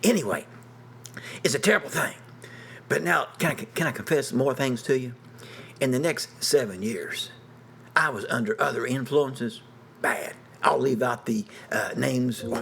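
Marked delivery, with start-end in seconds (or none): none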